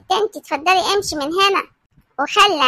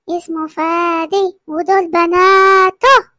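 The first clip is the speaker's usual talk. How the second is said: slow, spelled-out, louder at the end; deliberate insistence; 3 dB louder, much slower than usual. Acted. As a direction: lay each word down firmly. Insisting on the true name. (امرأة تصر ببطء وكلمة كلمة)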